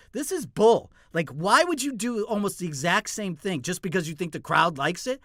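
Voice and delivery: high, loud exasperated complaint; indignant rant. Retold or imitated. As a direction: fume at the copycat. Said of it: "I was like".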